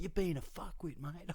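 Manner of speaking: high-pitched mock aggro fan; imitated insult. Rival fan having a go at the storyteller, mock aggressive. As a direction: mimic an annoyed bloke having a go.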